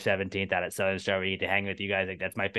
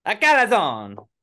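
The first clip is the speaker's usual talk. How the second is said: mock Italian accent; 11 semitones higher, 9 dB louder, much slower than usual.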